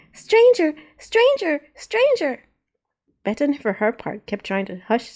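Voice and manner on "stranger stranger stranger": put-on high, loud repeating little girl's cry; siren-like child yell. A narrator's reading. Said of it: "continued yelling like a siren".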